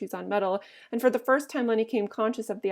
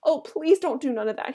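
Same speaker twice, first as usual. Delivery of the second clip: higher, louder, tearful-sounding plea; begging with fright; 5 semitones higher, 5 dB louder than usual. A narrator's reading. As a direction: plead as if about to cry. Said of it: "he begged".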